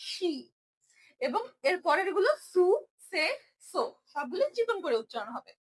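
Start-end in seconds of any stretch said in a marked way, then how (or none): 0.1-0.3 শি said as sh, not si; heavy shi. Shown as a demonstration. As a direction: say শি with a full heavy sh. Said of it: "এটা সি না". (ভারী করে শি)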